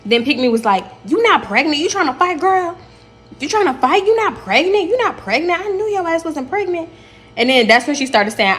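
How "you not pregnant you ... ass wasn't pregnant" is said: high-pitched goading, imitating her; high, singled-out accusations in a put-on voice; mock taunting. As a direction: mimic a mouthy girl picking a fight. Acted quarrel, taunting pregnant girl.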